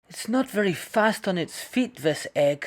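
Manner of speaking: a hint of a pleased wolf; lightly voiced wolf. The wolf eyes the egg.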